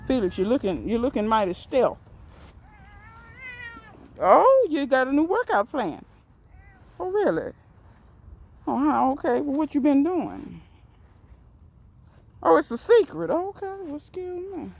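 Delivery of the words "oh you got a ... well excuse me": high, chatty voice for the cat; pet talk. Pretend. Gardener teases Felix about his workout, high and playful. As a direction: tease the cat in a raised sing-song.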